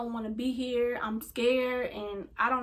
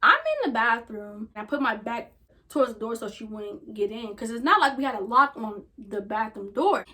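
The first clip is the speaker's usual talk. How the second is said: ordinary delivery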